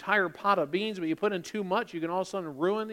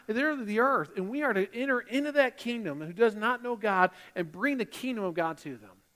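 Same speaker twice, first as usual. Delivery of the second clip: ordinary talk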